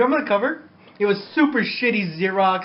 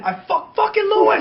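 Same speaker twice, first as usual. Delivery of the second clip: loud yell at a friend; angry yell; 13 semitones higher, 4 dB louder than usual. Retold or imitated. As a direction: shout his name in anger. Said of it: "I remember I said it loud".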